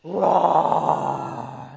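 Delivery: playful lion roar. Shown as a demonstration. Teacher roars like a lion with the children, playful roar. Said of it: "Let's take a big breath in".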